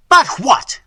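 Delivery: loud, high, sharp demand; prince's imperious outburst; impatient cry. A narrator's reading. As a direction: cut in on the stammering servant. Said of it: "cried the prince".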